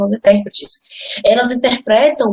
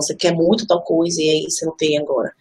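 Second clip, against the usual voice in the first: ordinary talk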